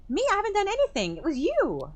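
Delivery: very high, loud indignant cry; shrill furious denial; outraged denial. Acted. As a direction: throw the blame back. Clarissa denying accusation.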